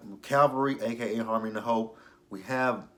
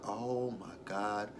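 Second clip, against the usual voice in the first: scornful aside; 7 dB quieter, slower than usual. Real, not acted. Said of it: "I'm like".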